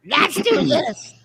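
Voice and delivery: slow, high mocking echo. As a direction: drag it out to mock him. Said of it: "mocking echo of host".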